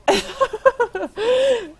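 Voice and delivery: high shaky laughter; laughing off fear. Real, not acted. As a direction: laugh through leftover fright. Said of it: "that was scary".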